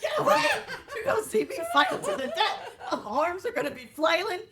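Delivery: high, loud panicked yelling; fighting screams. Pretend. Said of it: "my death scene's gonna be like".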